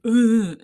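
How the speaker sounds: loud fed-up ugh groan; husband's groan. Retold or imitated. Husband groaning at his wife's advice, loud ugh. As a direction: let out a loud annoyed ugh. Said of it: "And he's like".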